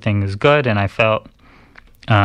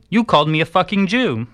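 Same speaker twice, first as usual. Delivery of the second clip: high-pitched, indignant comeback; retold indignant protest; 9 semitones higher than usual. Cyclist answering his attacker, retold indignant protest. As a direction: throw the accusation back, voice jumping up.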